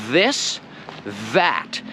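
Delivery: "This, that" with voiced th; correct th. Shown as a demonstration. Teacher modelling the th sound. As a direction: model "This" then "that". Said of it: "how to pronounce the T H".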